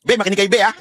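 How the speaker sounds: usual speaking voice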